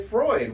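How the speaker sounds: Freud said with oi, like froyd; German pronunciation. Shown as a demonstration. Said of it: "they don't say Sigmund Freud".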